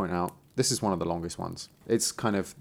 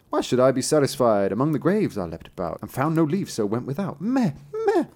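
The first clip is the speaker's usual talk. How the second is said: lightly voiced goat, bleating high at the end; a milder goat complaint with high bleats; 3 semitones higher than usual. Wicked beast answers the tailor, light goat voice and bleats.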